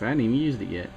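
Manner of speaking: ordinary talk, nothing marked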